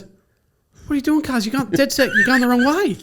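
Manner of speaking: high-pitched imitation of an alarmed mate; acted urgent, incredulous telling-off; insistent warning. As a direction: call out the mistake, voice way up. Acted friend insisting on wrong turn.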